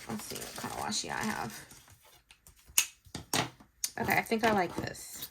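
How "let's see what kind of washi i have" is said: low whispered muttering; whispering to self. Real, not acted. Crafter to herself checking washi tape, whispering. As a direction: whisper it privately while looking.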